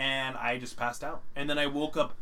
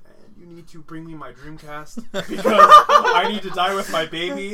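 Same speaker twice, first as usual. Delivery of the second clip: laughing mock wail; 4 semitones higher, 13 dB louder than usual. Drunk dramatic plea, laughing.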